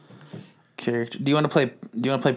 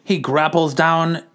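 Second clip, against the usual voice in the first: lightly voiced newsreel announcer; a hint of the old newsreel narration; old-timey narrator; 5 semitones higher than usual. A narrator's reading. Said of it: "me as the old-timey narrator".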